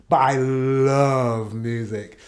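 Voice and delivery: LOVE drawn out long and slow; emphatic LOVE. Real, not acted. Music fan tells viewers their favorite thing, long drawn-out LOVE. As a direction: stretch the word "love" right out. Stressing music over comedy as passion.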